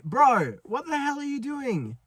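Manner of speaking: high-pitched, incredulous complaint; retold exasperation. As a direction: protest in a high, disbelieving voice. Retold exasperation at mum's eye-roll.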